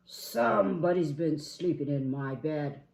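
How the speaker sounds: loud, indignant big bear; Papa Bear. Acted. Papa Bear at his bed, indignant boom. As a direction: boom out the accusation.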